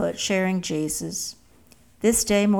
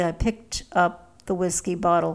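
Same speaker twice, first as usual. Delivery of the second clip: ordinary delivery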